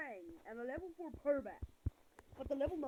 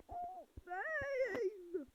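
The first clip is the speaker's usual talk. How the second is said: excited; 12 semitones higher, 4 dB louder than usual. Real, not acted. Excited at his Pokemon.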